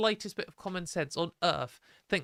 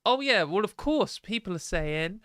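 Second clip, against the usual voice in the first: higher, louder mock-casual voice; mocking imitation; 5 semitones higher, 5 dB louder than usual. Streamer imitating the man's claim about transitioning, mocking. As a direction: parody his silly logic, pitched up and loud. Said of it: "and he's like".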